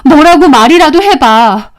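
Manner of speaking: wife demanding an answer; angry demand. Acted. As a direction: confront him, quickening as it goes. Wife confronts her silent husband.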